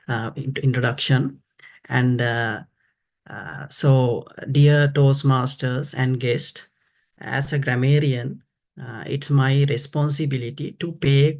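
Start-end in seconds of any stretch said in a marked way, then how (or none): none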